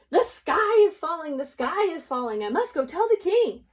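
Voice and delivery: high, loud hen shouting in a hurry; frantic alarm. Acted. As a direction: sound the alarm to everyone. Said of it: "didn't even stop".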